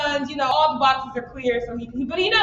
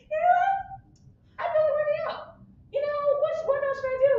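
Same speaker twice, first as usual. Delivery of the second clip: put-on much higher-pitched girlish musing; high, halting pretend pondering; character musing; 12 semitones higher, 3 dB quieter, much faster than usual. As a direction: think aloud in a high put-on voice. Improviser voices Asia waking up.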